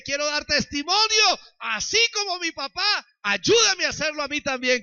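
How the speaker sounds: prayer swelling from firm to a loud cry; fervent prayer building to shout. Retold or imitated. Son crying out to God to worship too. His prayer, rising in fervour.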